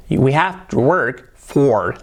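very slow "work for", R's held; slow, R-coloured "We have to work for"; strong English R. Shown as a demonstration. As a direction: model "for" with a drawn-out English R. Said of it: "la R".

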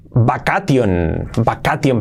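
"vacation" read as "ba-ka-tion"; Spanish-style mispronunciation. Shown as a demonstration. Teacher showing students the trap, mispronounced as ba-ka-tion. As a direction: say "vacation" letter by letter, Spanish sounds. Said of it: "cuidado, la pronunciación es".

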